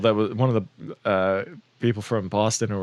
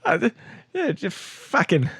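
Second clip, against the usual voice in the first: put-on Boston accent; 9 semitones higher than usual. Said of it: "you just do a Bill Burr".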